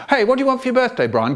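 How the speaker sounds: put-on high, rapid child's chatter; wheedling younger sibling. Child trying to talk to chanting brother, high quick wheedle. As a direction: pipe up high and quick, imitating a kid.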